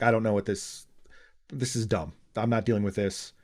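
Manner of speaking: low, soft imitated brush-off; retold weary dismissal. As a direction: quietly refuse to get involved, as a retelling. Acted brush-off by ex-CIA head.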